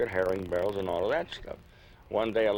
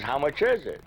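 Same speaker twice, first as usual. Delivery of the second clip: customer asking; 6 semitones higher than usual. Retold or imitated. Customer asks the price.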